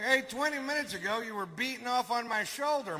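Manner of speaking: high-pitched comic protest; mock indignant retort. Retold retort to his conscience.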